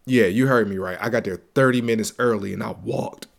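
normal talking voice

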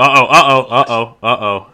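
loud, high startled uh-oh; alarmed uh-oh. Real, not acted. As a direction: caught off guard, loud. Clip auto-playing by mistake.

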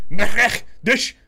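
high, loud guttural gibberish; guard's gibberish. Acted. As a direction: bark out a made-up monster tongue. GM voices guard's strange language.

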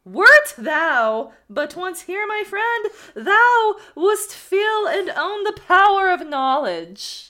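slow, loud, high and grand proclamation; theatrical oracle. Acted. Man in the sack boasting to the student, theatrical and grand. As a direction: declaim it like a wise prophet. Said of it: "this needs to be really theatrical".

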